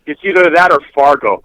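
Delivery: ordinary talk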